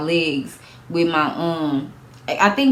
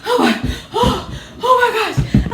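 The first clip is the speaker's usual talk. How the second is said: panicked oh my gosh. Retold or imitated. Panicked exclamation recalling the burn.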